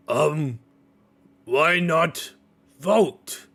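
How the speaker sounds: loud, high, halting dim-witted suggestion; Torgal. Acted. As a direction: blurt it out word by word. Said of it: "Torgal speaks up and he goes".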